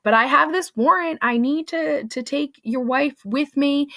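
insistent officer. Retold or imitated. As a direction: press the warrant on him. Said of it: "Cheever's like".

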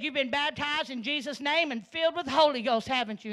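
bursting to hear the answer; excited question. Retold or imitated. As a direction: neighbor lady asks the twin eagerly, rising excitement. Neighbor lady asks the twin eagerly.

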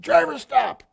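high, loud commander's command; retold shouted order. Commander orders the driver to halt the burning tank, shouted. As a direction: call out an urgent halt to the driver.

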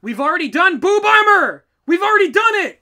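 loud, high exasperated outburst; we've already done it, yelled. Real, not acted. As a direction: shout it, fed up with the repeat. Exasperated at repeated rule.

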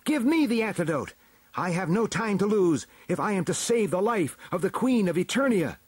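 high, insistent hero-like voice; urgent demand. A narrator's reading. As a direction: press the elders, time is short. Double urgently demands the antidote.

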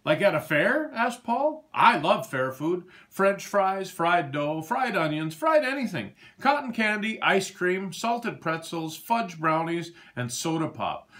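a greedy pig dreaming of fair food; excited pig. A narrator's reading. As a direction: rattle off treats with mounting glee. Paul excitedly lists fair food.

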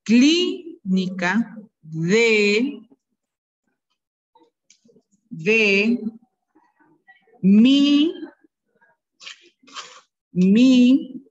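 each word drawn out slowly for the class; teacher dictating slowly; slow dictation. Shown as a demonstration. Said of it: "voy a ir dictando".

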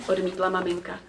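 ordinary delivery

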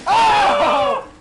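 loud surprised yelp; startled shout. Real, not acted. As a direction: cry out in shock. Sudden attack in game.

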